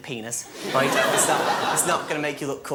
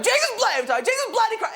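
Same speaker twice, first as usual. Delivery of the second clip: mum's exasperated yell; 4 dB louder, faster than usual. Retold or imitated. Mum finding his untidy room, shrieking much higher. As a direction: shriek it like a fed-up mother.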